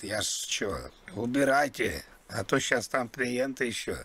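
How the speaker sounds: usual speaking voice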